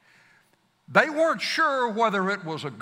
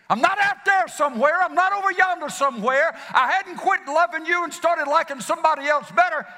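fervent insistence; 6 semitones higher than usual. Acted. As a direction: pour it out urgently, pleading to be believed. Jesus insisting on his love.

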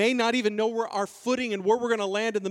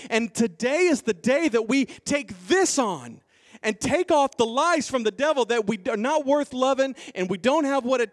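raised, driving sermon delivery; impassioned preaching; 5 semitones higher, 4 dB louder than usual. Real, not acted. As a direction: press it on the congregation with conviction. Preaching at full force.

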